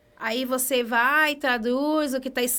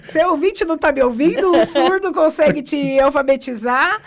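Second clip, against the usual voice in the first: loud amused disbelief; 5 semitones higher, 10 dB louder than usual. Real, not acted. Laughing disbelief about the deaf husband.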